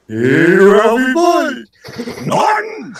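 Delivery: very high, drawn-out bellowing call; loud, high-pitched comic holler; comic skit character. Acted. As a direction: holler it out loud and slow like a hammy sitcom husband. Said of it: "that's another skit I'm working on".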